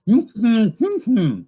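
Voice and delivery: loud, high wordless hum with lips sealed; mouth-closed talking. Shown as a demonstration. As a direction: talk in hums through a shut mouth. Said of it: "you can teach them".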